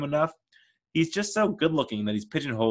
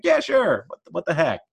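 high, easygoing yes; 5 semitones higher, 4 dB louder than usual. Retold or imitated.

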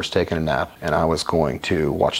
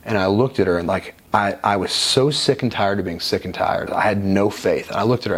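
ordinary talk

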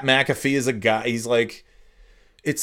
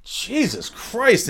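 ordinary talk, nothing marked

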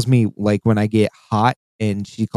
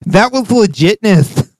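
high-pitched hammed-up celebration; mock triumph; 9 semitones higher, 3 dB louder than usual. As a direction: squeal it like a trick just landed. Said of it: "you hear one of us go".

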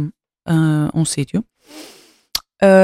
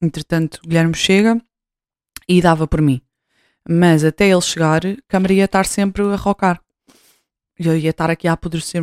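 usual speaking voice

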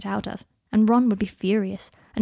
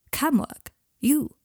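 "Cam work?" pitched up high in disbelief; an incredulous, higher voice for Malfoy's "Cam work"; surprised disbelief; 6 semitones higher, much slower than usual. A narrator's reading. Malfoy can't believe Harry wants cam work, incredulous.

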